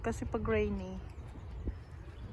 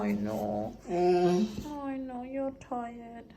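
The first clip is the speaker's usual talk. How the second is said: soothing a pet; 2 semitones higher, slower than usual. Real, not acted. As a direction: lull her toward sleep. Soothing tired Molly to sleep.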